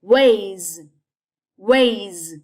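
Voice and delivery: Ways with a long buzzing z at the end; Ways, said strongly as wayzzz; final Z sound. Shown as a demonstration. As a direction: stretch Ways out into zzz. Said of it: "Mình sẽ đọc là âm Z".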